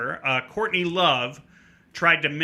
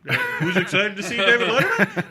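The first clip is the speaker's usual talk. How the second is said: higher-pitched, laughing echo of a hype call; defensive quoting; 9 semitones higher, 4 dB louder than usual. Acted. Fan to scolding staff, quoting the page's call in his defence. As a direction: parrot the crowd call back, half laughing. Quotes page back in self-defence.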